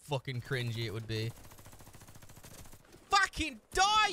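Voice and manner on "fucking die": much higher, louder yell; yelling at a player to die; angry shout. Real, not acted. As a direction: bark it at the enemy. Shooting at an enemy.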